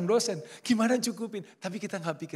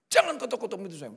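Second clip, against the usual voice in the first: fast, higher mock scolding; retold angry woman at the door, quick and raised; 8 semitones higher, 5 dB louder, faster than usual. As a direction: shoo the stranger from the door, sharply. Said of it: "Marah-marah dia".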